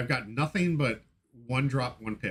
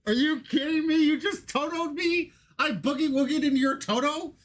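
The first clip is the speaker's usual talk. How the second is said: high-pitched outraged disbelief; recreated disbelief; 13 semitones higher than usual. Retold or imitated. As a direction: shriek it like you can't believe your luck turned. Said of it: "I'm trying to recreate my experience".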